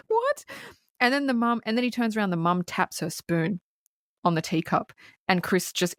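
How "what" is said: high-pitched rising disbelief; mock shock. Viewer watching her turn on a dime, mock shocked. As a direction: act stunned, voice shooting up.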